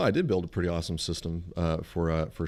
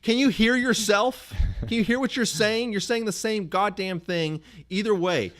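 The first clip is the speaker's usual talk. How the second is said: ranting at Siri; 9 semitones higher than usual. Retold or imitated. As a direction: lecture the phone that won't listen. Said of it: "It's like".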